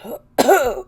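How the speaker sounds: sudden choking cough; real choking cough mid-sentence. Real, not acted. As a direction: cough hard, as if choking on coffee. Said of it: "I almost choked here".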